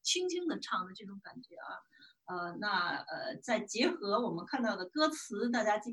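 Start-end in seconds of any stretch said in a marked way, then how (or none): 0.0-1.8 soft. Shown as a demonstration. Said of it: "要唱的比较轻的".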